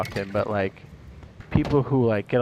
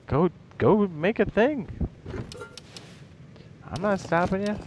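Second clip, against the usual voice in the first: acted exasperated urging; 8 semitones higher than usual. YouTuber answering resentful critics, exasperated urging. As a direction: urge them on, pitched well up.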